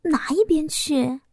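playful bashful protest; coy. A narrator's reading. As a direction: shoo him away shyly. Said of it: "娇羞地说着".

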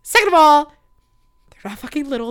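loud, high indignant outburst; mock outrage. Indignant rant about cankles.

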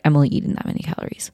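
fast, breathy whispered aside; mock whisper. Imitating a dieter admitting her calorie count, fast whisper. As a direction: rush it out under your breath like a sheepish dieter. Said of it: "someone who's like".

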